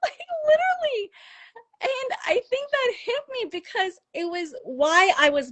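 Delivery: real tearful laugh, voice pitched very high; choked up, high and squeaky through tears; tearing up. Real, not acted. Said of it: "I'm still tearing up".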